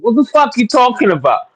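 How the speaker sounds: high shouted outburst; angry yell. Real, not acted. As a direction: fire it back, furious and high. Caller snaps back, insulted.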